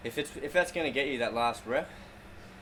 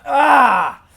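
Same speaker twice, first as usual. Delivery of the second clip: effort scream; 14 semitones higher, 19 dB louder than usual. Real, not acted. Straining through last rep.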